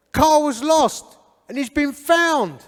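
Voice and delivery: high, loud proclamation to a crowd; proclaiming. Retold or imitated. Elders announcing to the packed church hall, loud proclamation.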